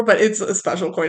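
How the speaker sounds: ordinary delivery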